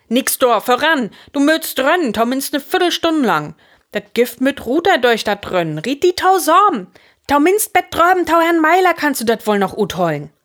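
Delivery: high, loud driving orders; urgent urging. A narrator's reading. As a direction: push an exhausted friend onward. Fritz urges exhausted boys onward. (luud un hild andrieven)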